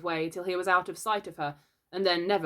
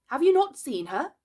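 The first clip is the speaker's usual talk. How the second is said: high, loud, mildly worried question; becoming troubled; 9 semitones higher, 5 dB louder than usual. A narrator's reading. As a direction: ask with growing concern. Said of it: "becoming troubled".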